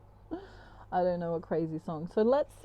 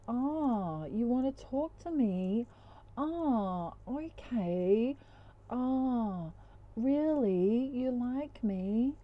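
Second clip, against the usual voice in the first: higher, drawn-out mock cluelessness; playing dumb; 4 semitones higher, 3 dB quieter, much slower than usual. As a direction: feign dim surprise on purpose. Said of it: "It's like the dumbest look".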